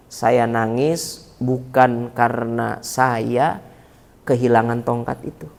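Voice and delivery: put-on very slow, low, soft grieving old man; Sunan Bonang. Weeping saint explains to the robber, slow hushed sorrow. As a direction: speak slowly and quietly, weighed with sorrow. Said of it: "dia bilang".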